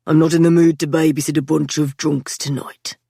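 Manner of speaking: a tired older brother, lightly voiced; Patrick's voice. A narrator's reading.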